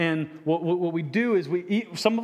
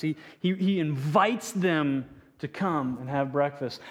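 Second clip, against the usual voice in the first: usual speaking voice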